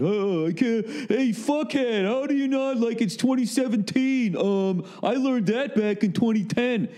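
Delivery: high, sneering put-on voice, "hey, fuckhead" dragged out slowly; mock the smug young Twitter kid; mocking young know-it-all. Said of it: "laugh your ass off at me on Twitter".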